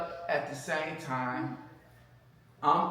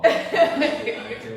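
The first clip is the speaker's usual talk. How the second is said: laughing; 10 dB louder, slower than usual. Real, not acted. Laughing at his own past.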